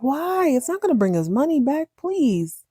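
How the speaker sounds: high-pitched acted plea; hammed-up mother begging her son; mock pleading. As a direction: plead with him, starting high on the why.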